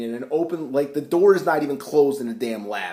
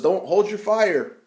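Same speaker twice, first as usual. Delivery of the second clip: 1 urgent shouted order; 7 semitones higher, 4 dB louder, slower than usual. Acted. General shouting to soldiers.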